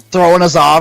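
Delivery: ordinary delivery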